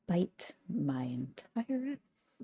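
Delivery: drawn out slowly; slow deadpan. Real, not acted. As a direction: stretch each word with contempt. Drawn-out insult.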